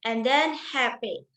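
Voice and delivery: ordinary talk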